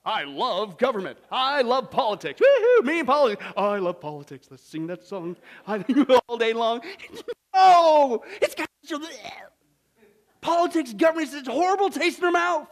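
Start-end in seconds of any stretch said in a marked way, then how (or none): 0.0-6.8 sarcastic gushing. Pretend. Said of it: "what's our attitude towards government today?".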